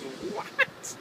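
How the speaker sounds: high, rising startled question; imitating her shocked reply; mock startled girl. Girl's startled reply, second time.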